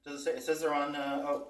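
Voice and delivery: normal talking voice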